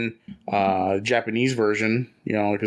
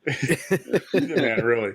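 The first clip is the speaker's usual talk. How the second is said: higher-pitched, slow words through laughter; laughing praise; 3 semitones higher, much slower than usual. Real, not acted. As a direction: laugh while praising a friend. Amused praise after funny clip.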